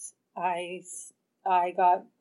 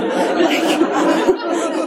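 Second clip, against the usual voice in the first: high laugh; 8 semitones higher, 6 dB louder than usual. Real, not acted. Laughs at her own story.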